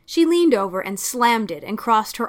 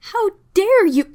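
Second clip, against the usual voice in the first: much higher, shouted outrage; furious outburst; 12 semitones higher, 9 dB louder than usual. Acted. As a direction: explode at him in fury. Sally vibrating with fury.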